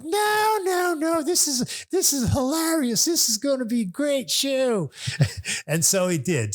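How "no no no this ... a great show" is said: very high, gushing host impression; exaggerated high-pitched delight, sustained to the end; Hugh. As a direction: impersonate an excitable host brushing off worries. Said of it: "He goes".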